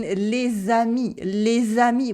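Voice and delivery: "les amis" linked with a Z sound; liaison. Shown as a demonstration. Teacher giving an example of liaison, "les amis" joined by a Z. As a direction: run "les" into "amis" as lay-zah-mee. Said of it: "linking the final consonants to initial vowels".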